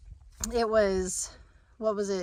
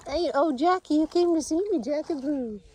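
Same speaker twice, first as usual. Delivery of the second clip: higher sing-song voice cooing at the dog; 7 semitones higher, 4 dB louder than usual. Real, not acted. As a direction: coo to the puppy in a high pet voice. Greeting dog fondly.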